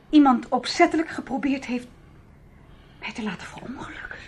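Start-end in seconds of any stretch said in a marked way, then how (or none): 3.0-4.2 hushed, unvoiced, dropping away; frightened whisper. Realizing someone wanted her dead, she whispers. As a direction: let the words die into a whisper.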